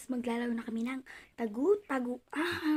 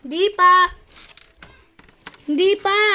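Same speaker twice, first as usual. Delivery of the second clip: loud call from hiding; 6 semitones higher, 20 dB louder than usual. Real, not acted. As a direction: shout it across the house. Calling out during hide and seek. (malakas na sigaw)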